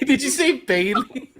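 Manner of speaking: high-pitched, drawn-out question through laughter; incredulous, squealing with laughter; laughing in disbelief. Real, not acted. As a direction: crack up while asking it. Cracking up at a co-host's slip.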